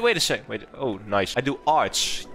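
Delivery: usual speaking voice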